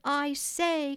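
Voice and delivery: lightly acted boyish cry; excited cry. A narrator's reading. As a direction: call attention to a discovery. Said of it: "cried John".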